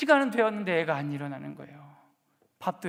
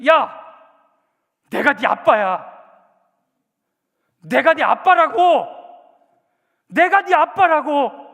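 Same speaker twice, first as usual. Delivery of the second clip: slow, loud, higher, trembling with rage; a father finally snapping at his child; furious dad shouting each word slowly; angry yell; 7 semitones higher, 12 dB louder, slower than usual. Retold or imitated. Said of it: "화가 나가지고 신문을 탁 들고".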